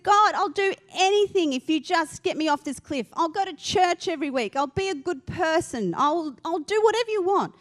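high, hammed-up bargaining; acted desperate pleading. Dangling man bargains with God for rescue, desperate plea.

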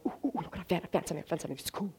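mock excited muttering; excited dog. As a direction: quietly voice a dog absorbed in his find. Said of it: "he's starting to get excited".

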